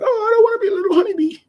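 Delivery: very high, loud, quick mock voice; mocking squeal. Imitating people teasing him over the honeybee, mocking squeal. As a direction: squeal it like a silly little bee.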